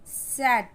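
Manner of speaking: "सैड" said loudly with the short A; emphatic, clear "सैड"; short A demo. Shown as a demonstration. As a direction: model "सैड" firmly for learners. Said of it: "इसमें भी आ का ही sound".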